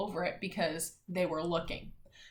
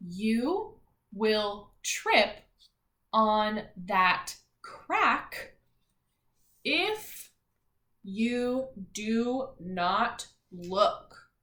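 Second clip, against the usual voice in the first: very slow, word by word; slow choral reading pace; slow reading aloud; much slower than usual. Shown as a demonstration. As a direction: read it out slowly for the class to follow. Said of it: "Ready? read it all together".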